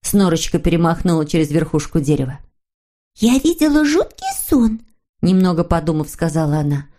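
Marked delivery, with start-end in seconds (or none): 3.1-4.8 put-on high girlish character voice; little girl figure. A narrator's reading. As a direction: tell it in a thin, high little voice. Snork Maiden voiced in story. (тонкий девичий голосок)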